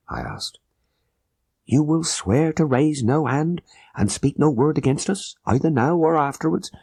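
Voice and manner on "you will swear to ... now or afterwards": lightly voiced conspirator, a touch higher; a hint of a tense, urgent plotter; Abdullah Khan. A narrator's reading.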